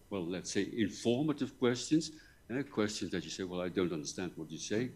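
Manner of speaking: normal talking voice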